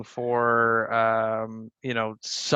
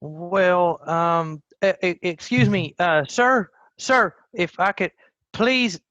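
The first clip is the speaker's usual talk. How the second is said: higher, hesitant polite call for attention; flustered hailing; 5 semitones higher, 4 dB louder than usual. Acted. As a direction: stammer as you try to stop a busy passer-by. Calling to a passing messenger.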